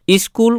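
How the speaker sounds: স্কুল with an extra i before the s, "iskul"; wrong pronunciation. Shown as a demonstration. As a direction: add a short i in front of স্কুল. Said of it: "কিন্তু উচ্চারণ হয় না". (স্কুল-এর আগে ই বসিয়ে ভুল উচ্চারণ, "ইস্কুল")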